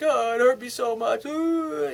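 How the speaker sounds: high-pitched imitation of a weeping girlfriend; hammed-up sobbing girlfriend voice; mock crying. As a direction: whine it high like a tearful woman, ending in a wail. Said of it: "She'll probably be crying".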